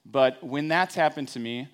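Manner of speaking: ordinary talk, nothing marked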